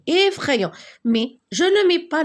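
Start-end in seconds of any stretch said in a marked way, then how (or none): 0.1-0.7 "Effrayant" with the opening E sounded as a clear é, pitched high; teacher sounding out the é that opens "Effrayant"; stressed first vowel. Shown as a demonstration. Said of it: "j'entends et".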